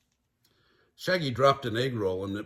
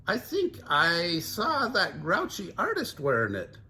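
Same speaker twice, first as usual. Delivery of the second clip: Shaggy voice; 5 semitones higher than usual. Acted.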